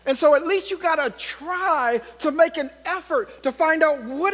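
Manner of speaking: loud, rising sermon fervour; preaching at full force. Acted. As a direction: drive it home to the pews, building intensity. Preaching to the congregation.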